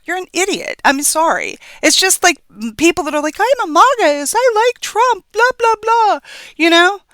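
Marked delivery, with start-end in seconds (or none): 3.4-6.2 high, sneering caricature of a Trump fan; mock MAGA voice. Vlogger mocking a Trump supporter to viewers, high sneering caricature. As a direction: pitch it way up and lampoon the MAGA fan.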